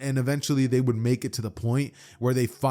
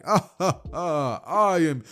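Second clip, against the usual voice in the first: hearty laugh; 6 semitones higher, slower than usual. Retold or imitated. All Might laughs, coughing blood.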